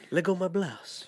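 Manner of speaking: lightly voiced wrestler accent; a hint of Nacho Libre. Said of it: "Nacho Libre".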